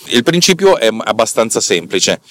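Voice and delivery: ordinary talk, nothing marked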